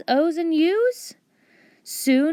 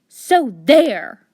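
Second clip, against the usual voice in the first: a louder, firm so there; louder bold comeback; bold retort; 3 semitones higher, 8 dB louder than usual. Acted. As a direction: plant your feet and say it boldly. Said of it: "boldly added".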